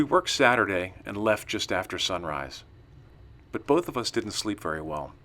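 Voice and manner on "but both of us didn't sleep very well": ordinary delivery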